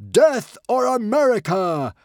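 very high, loud battle cry; rally the mob with a savage cry; wild shout. Acted. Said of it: "shouted Norbert the Nutjob".

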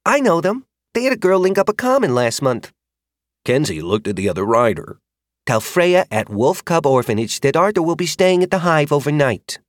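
put-on high, brisk girl rider voice; lightly voiced confident rider; rider instructing.

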